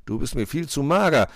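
big, jovial character voice; amused cook. A narrator's reading. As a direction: relish it boisterously as the cook. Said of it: "Der Koch lachte".

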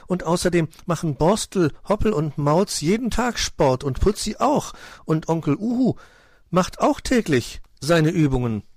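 put-on high, animated childlike listing; eager young nephew. Nephew lists sporty friends.

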